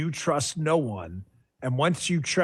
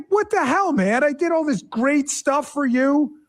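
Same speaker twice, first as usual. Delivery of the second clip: exasperated wife; 8 semitones higher than usual. Retold or imitated. Husband imitates his wife scolding him over the party, high and exasperated. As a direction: whine it like a wife fed up with her husband. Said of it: "and she's like".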